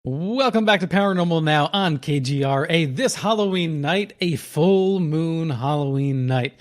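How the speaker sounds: slow, low late-night radio host; ominous late night radio. Acted. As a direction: draw out the full moon welcome. Radio host opens the segment.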